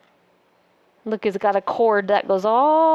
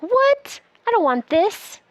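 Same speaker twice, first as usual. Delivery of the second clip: high, huffy voice of a cartoon figure; mock grumpy character; 8 semitones higher than usual. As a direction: play the grumpy bird refusing. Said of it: "He's like".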